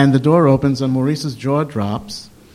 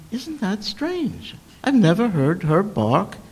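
puzzled; 7 semitones higher, slower than usual. Retold or imitated. Puzzled thought as poodle barks.

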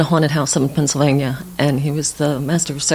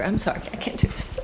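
barely holding back tears, breathy; choked up; 6 semitones higher, 6 dB quieter than usual. Real, not acted. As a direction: voice breaking into a whispered apology. Grieving friend's memorial poem.

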